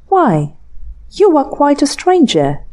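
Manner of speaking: sly coquettish reproach; arch. A narrator's reading. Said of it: "cried Grace archly".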